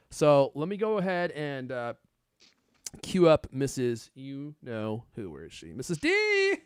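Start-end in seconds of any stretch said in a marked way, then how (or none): 5.7-6.5 calling out. Acted. Host calls out for the character.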